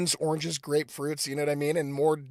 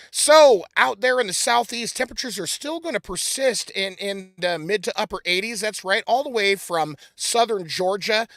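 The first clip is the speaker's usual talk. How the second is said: weatherman forecast; 3 semitones higher, 6 dB louder than usual. Acted.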